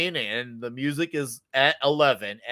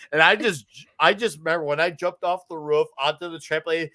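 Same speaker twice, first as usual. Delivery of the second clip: ordinary delivery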